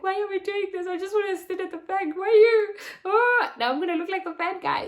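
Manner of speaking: high, loud, near-tearful complaining; fretful inner voice. Retold or imitated. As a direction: whine it like you're about to cry. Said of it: "I'm like".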